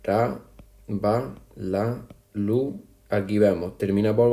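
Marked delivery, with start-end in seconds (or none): 0.0-2.8 syllable by syllable, each beat apart; teacher spelling out syllables slowly; split into syllables. Shown as a demonstration. Teacher to class, separating the verse into syllables. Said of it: "Pues lo separamos".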